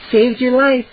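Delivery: plain reading voice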